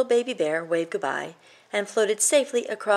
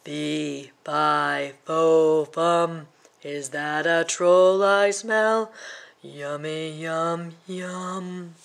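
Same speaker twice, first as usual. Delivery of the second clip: slow, drawn-out giant chant; menacing giant; much slower than usual. Acted. Giant smelling the troll, slow hungry chant. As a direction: savor every word like a hungry giant.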